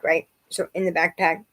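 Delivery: ordinary talk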